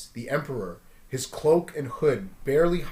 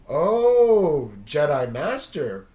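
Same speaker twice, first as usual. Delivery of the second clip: knowing creature; 6 dB louder, much slower than usual. Acted. Creature recognizes the name.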